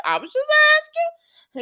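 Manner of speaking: high, loud, defensive squeal; defensive Sharon. Retold or imitated. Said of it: "And she was like".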